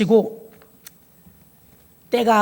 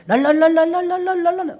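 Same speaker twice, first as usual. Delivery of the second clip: high tearful 랄랄랄 babble; 랄랄랄 trilled high and quick; tongues babble; 7 semitones higher than usual. Retold or imitated. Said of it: "막 눈물이 나더라고".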